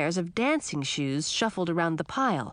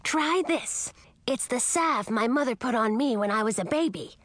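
put-on higher, eager young dragon; a lively young dragon asking politely; 3 semitones higher than usual. Young dragon Ralph speaks.

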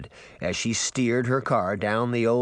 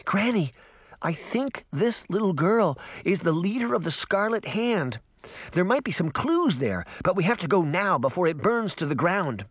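put-on slightly higher girl's voice, urgent; lightly voiced girl; 8 semitones higher than usual. Girl urging granny to go now, lightly voiced urgent girl. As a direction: lightly voice an eager girl, pitched up. Young girl's excited lines.

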